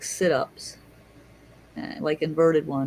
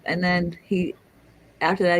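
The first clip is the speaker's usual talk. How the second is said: usual speaking voice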